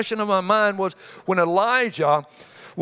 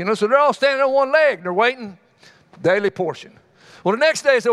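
normal talking voice

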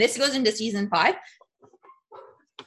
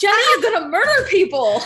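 higher, laughing mock alarm; mock outrage; 6 semitones higher, 4 dB louder than usual. Fan warns of mother's fury, mock outraged. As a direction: warn him with amused horror. Listener mock-scolds the character.

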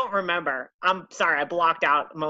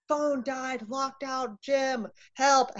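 frantic all caps plea; 8 semitones higher, much slower than usual. Retold or imitated. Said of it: "typing furiously in all caps".

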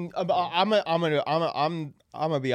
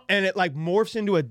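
normal talking voice

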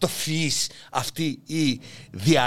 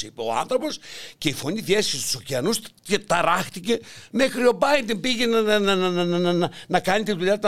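ordinary talk

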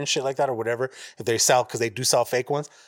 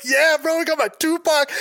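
much higher, louder, thrilled yelp; excited cheer; 13 semitones higher, 4 dB louder than usual. Retold or imitated. Collector retelling his unboxing, excited high cheer. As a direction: cheer like the prize just arrived. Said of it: "I was all happy".

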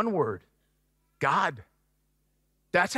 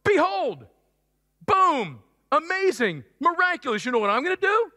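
excited God voice; 3 semitones higher than usual. Acted. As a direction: announce the miracle with building wonder. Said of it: "So God says".

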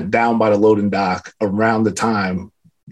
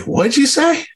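sharp high call down the hall; calling back. Retold or imitated. Speaker turns back to the linen guy, high startled call.

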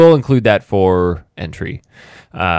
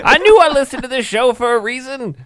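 much higher-pitched exclamation; mock amazed listener; 3 dB louder than usual. Imagined listener learning about toilet seats, mock amazement. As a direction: play a listener having a revelation, hammed-up.

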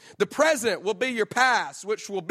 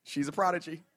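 amused aside; 2 semitones lower, 5 dB quieter than usual. Retold or imitated. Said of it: "was like".

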